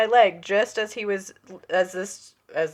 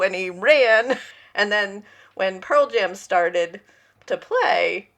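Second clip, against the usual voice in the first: ordinary talk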